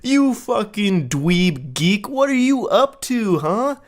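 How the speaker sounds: hammed-up dweeby flirt voice rising high; mock playful insult. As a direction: drag out each insult like a smug bully. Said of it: "the playful insults kind of thing".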